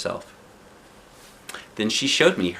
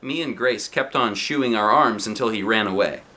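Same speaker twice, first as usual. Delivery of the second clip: ordinary delivery, nothing marked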